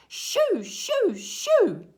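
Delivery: loud, high repeated calls; shooing goats. A narrator's reading. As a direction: chase them off with each burst. Boy chasing goats again.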